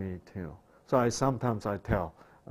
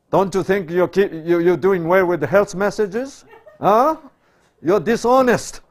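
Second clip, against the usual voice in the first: loud, higher accusing rebuke; condemning preacher; 8 semitones higher, 14 dB louder than usual. Acted. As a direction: scold them hard, jabbing at dishonesty. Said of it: "I'll say this".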